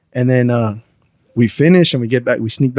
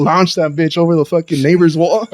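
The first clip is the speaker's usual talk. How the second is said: normal talking voice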